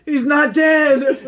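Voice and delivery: high, loud and drawn out; incredulous shout. Real, not acted. Friend recounting absurd menorah scene, incredulous shout. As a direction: cry out in disbelief at the plot. Exclaiming over absurd film plot.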